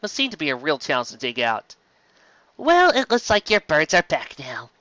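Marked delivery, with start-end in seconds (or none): 2.6-4.7 bright, slow, higher tone; forced cheer to a shy pony; brightly. A narrator's reading. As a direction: put on a cheerful face. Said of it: "she said brightly".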